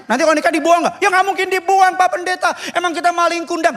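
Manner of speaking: high, emphatic protesting voice; retold denial. Bride-to-be assures pastor parents won't be abandoned, high and emphatic. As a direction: imitate someone insisting indignantly. Acted exchange, indignant denial.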